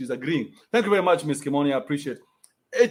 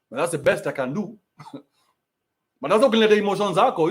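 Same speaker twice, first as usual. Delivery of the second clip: ordinary talk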